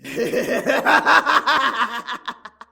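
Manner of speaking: booming evil laugh; villain laugh. Acted. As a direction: cackle like a movie villain. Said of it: "That's a very nice villain voice you got there".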